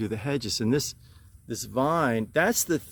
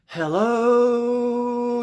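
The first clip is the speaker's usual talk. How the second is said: cheery greeting; 11 semitones higher, 6 dB louder than usual. Acted. Greeting viewers.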